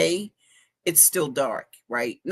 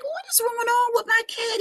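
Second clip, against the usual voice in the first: high alarmed. Retold or imitated. Quoting own alarm.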